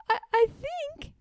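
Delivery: high, stammering little voice; nervous Piglet. A narrator's reading. Piglet makes an excuse, high and stammering. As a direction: stammer out of fear.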